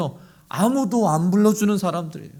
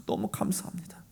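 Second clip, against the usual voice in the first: breathless whisper of gratitude; whispered thanks; 12 dB quieter than usual. Pretend. Grateful worker thanking the Lord, whispered. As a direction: hush it like a prayer.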